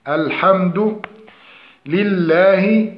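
slow الحمد لله with throaty ḥ; correct الحمد. Shown as a demonstration. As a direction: recite الحمد لله slowly, each vowel complete. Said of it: "فنقول الحمد". (الحمد لله بحاء محققة وببطء)

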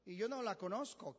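ordinary talk, nothing marked

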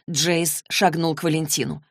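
ordinary delivery, nothing marked

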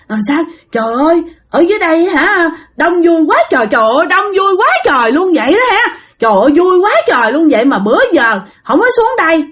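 high, gleeful voice chatting with unseen company; eerie delighted possessed boy. Retold or imitated. Possessed boy cheerfully talks to invisible ghosts, high and gleeful. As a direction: sound thrilled by a crowd no one else sees.

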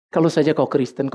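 ordinary talk

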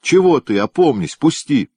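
mock alarm. A narrator's reading. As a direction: pretend to be scared, laughing inside. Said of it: "нарочито испуганным голосом закричал". (нарочито испуганный голос)

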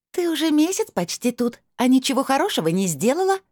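very high, reproachful otherworldly voice; crystal-bell voice. A narrator's reading. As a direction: ring out high like tiny crystal bells. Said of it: "словно тысячи хрустальных колокольчиков внезапно зазвенели". (высокий звенящий голос, с упрёком)

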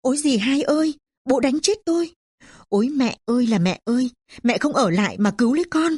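high-pitched plaintive wail; acted cry to a dead mother. A narrator's reading. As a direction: wail for help like a beaten daughter. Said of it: "miệng kêu khóc".

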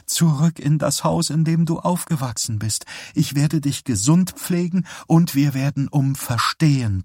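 a pious mother promising to nurse her son; the zealous mother, smothering and sure; devout mother. A narrator's reading. Narrator voices the mother.

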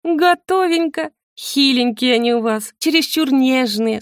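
high, slow, self-satisfied ghost; smug. A narrator's reading. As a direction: announce success with drawn-out smugness. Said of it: "самодовольно заявила". (самодовольно, высоко, нараспев)

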